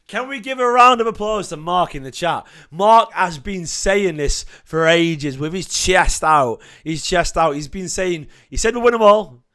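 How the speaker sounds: rally the chat to cheer for Mark; excited hype. Real, not acted. Hyping a fan's prediction to chat.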